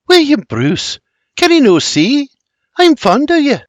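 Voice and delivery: high, earnest Scottish lilt; Scottish confession. A narrator's reading. Mary gazes into William's eyes, earnest Scottish confession. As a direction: plead fondly in a high Scottish voice.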